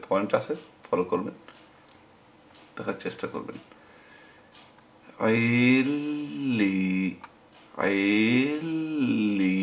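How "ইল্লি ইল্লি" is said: ইল্লি with a long drawn-out ই, very slow; ইল্লি held long, slow and deliberate; lengthened vowel. Shown as a demonstration. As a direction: stretch the ই in ইল্লি like a recitation drill. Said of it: "সেজন্য এটা লম্বা হয়েছে".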